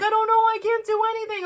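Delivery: high, frantic helpless reply; helpless panic. Retold or imitated. Mom to son mid-rescue, high helpless panic. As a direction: throw it back flustered and pitched high.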